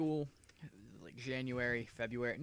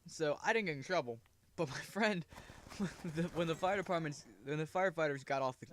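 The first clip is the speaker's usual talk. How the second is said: normal talking voice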